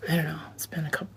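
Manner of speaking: whispering. Real, not acted. Whispered aside mid-sentence.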